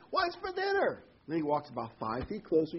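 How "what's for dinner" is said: high-pitched yell across the house; acted yell. Husband yells at his wife from across the house, high mock yell. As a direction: call it out to a distant wife. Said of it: "he yells at his wife".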